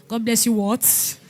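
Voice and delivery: usual speaking voice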